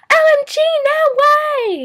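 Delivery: put-on gushing hallway girl. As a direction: squeal it like an excited teen girl. Imitating girls in school hallway.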